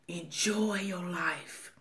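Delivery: soft, slow whisper. Real, not acted. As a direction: breathe it out gently. Closing heartfelt advice to viewers.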